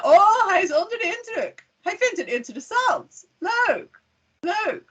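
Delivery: high-pitched, exaggerated delight in Dutch; impressed Dutch person. Shown as a demonstration. Naive Dutch listener taking English politeness literally, hammed-up impressed. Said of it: "the Dutch person says".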